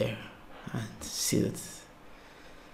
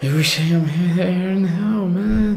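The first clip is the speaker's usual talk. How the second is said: excited; 7 semitones higher, 9 dB louder than usual. Real, not acted. Overwhelmed watching Thailand night footage.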